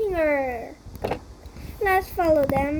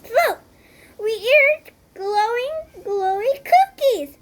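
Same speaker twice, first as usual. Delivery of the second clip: higher, louder, slow exclaim; excited discovery; 7 semitones higher, 5 dB louder, slower than usual. Acted. Hero spotting glowing cookies, excited higher call. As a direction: point it out with wonder.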